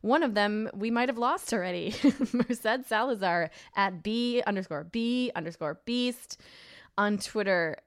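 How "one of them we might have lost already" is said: normal talking voice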